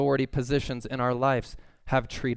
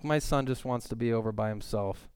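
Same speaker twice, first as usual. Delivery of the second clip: usual speaking voice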